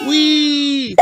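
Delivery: high, gleeful cry; excited whee. A narrator's reading. Peppa slides down the hallway, gleefully. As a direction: squeal as you slide. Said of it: "cries Peppa".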